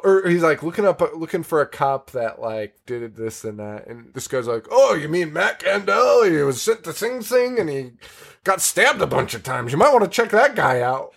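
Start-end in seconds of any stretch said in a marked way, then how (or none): 4.7-11.1 chatty cop voice. Retold or imitated. Voicing the bozo cop.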